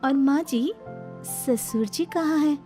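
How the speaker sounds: high, pouting complaint; plaintive snake-bride. Acted. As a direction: whine sweetly like a wronged young wife.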